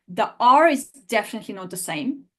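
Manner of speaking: ordinary delivery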